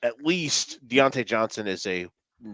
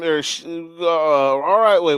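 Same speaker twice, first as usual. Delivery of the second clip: ordinary talk, nothing marked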